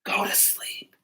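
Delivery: very soft whisper, slowing right down; hushed, drowsy reassurance trailing into slowness; sleepy whisper. A narrator's reading. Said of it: "whispered a sleepy little fox".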